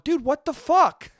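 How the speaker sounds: mock-offended science guy; indignant Bill. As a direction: drag out the outrage like a jealous rival. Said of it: "Bill Nye must be sitting there going".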